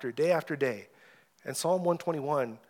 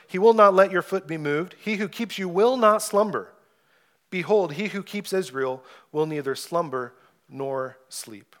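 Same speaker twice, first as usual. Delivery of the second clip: louder, ringing proclamation of the psalm; scripture reading; 3 semitones higher, 5 dB louder than usual. Acted. Pastor reading the psalm to his congregation, ringing and bold. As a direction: read it out boldly like a preacher at the pulpit. Preacher rereads verses three and four.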